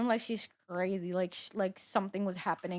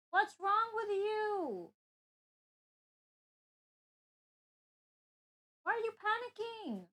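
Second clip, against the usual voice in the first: much higher, slow sing-song talk to a pet; 10 semitones higher, slower than usual. Real, not acted. Streamer asks her panicking cat what is wrong, in high slow pet talk.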